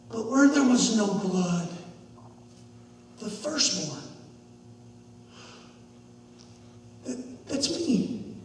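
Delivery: hushed, low, with long pauses; dawning dread. Acted. Boy recalls sermon about firstborn, hushed realization. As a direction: let it sink in, softly.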